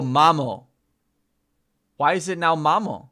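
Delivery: ordinary talk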